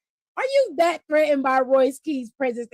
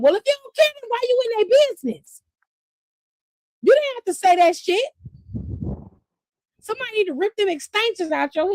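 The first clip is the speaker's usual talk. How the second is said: ranting; 8 semitones higher, faster than usual. Real, not acted. Vlogger scolding Damian for snitching, high indignant rant.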